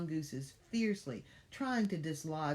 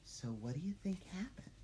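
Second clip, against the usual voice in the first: whisper; 5 semitones higher, 6 dB quieter than usual. Real, not acted. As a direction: draw them in with a whisper. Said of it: "asks kids, whispering".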